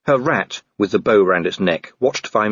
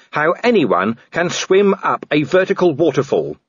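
pitched-up, exasperated watchman; a hint of an angry Vimes; 8 semitones higher than usual. A narrator's reading. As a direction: push back at her, exasperated.

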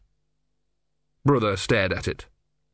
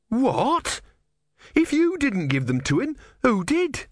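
put-on young novice, indignant; 14 semitones higher than usual. A narrator's reading. Novice voiced by narrator.

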